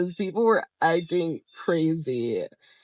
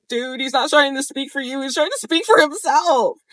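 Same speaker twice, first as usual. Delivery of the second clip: high, raised voice acting out an argument; heated reenacted comeback, louder toward the end; exasperated retort; 8 semitones higher, 4 dB louder than usual. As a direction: argue back insistently, pitch climbing. Retelling her retort in argument.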